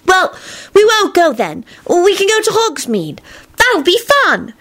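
put-on high, bright-eager boy voice; upbeat Teddy. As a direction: cheer a frightened friend up. Teddy soothes Kenny.

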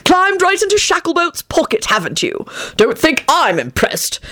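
high, scornful irritation; irritable growling reproach; growled. A narrator's reading. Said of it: "Arthur growled".

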